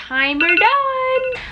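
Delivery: high, slow sing-song call; sing-song announcement. Real, not acted. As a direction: announce it like a chime.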